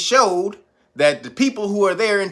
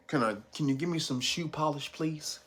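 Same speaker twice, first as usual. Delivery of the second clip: much softer, lower, hammed-up pleading; mock meek request; 5 semitones lower, 14 dB quieter than usual. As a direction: ask sheepishly under the breath. Voicing Tunisians asking for polish.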